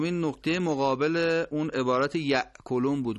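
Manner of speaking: normal talking voice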